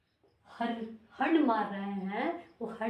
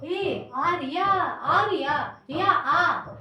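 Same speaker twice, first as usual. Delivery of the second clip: calling out =177; 5 semitones higher, 9 dB louder, much slower than usual. Acted. Mnemonic story, calling to Arya.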